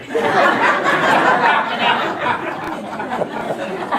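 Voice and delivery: long loud, high real laughter. Real, not acted. After Papa Joe's headache joke, long real laughter. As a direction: let the laugh roll out.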